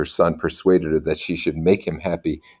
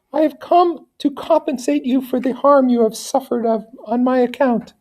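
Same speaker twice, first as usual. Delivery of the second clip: soothing her sick son in a much higher voice; high gentle lady voice. Acted. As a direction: promise it like a loving noble mother. Lady speaks to Federico.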